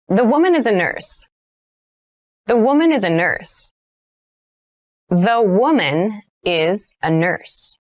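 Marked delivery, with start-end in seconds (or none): 5.1-7.8 slow, careful sentence for learners; slow model read. Shown as a demonstration. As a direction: say it slowly so students can copy. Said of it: "teacher models sentence, third take".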